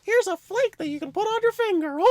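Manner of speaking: squeaky gleeful dad offering a treat; giddy dad. Retold or imitated. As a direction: play the overexcited dad. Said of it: "my dad was like".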